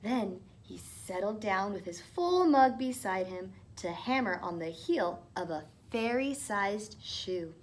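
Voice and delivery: usual speaking voice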